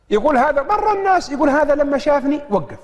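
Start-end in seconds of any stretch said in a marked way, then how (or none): none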